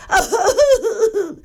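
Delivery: acted boo-hoo sob; high, hammed-up little sob; high baby-bear wail. A narrator's reading. Baby Bear bawls over his chair, high mock crying.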